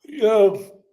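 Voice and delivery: words through a yawn. Real, not acted. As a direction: stretch it out mid-yawn. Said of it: "I'm yawning".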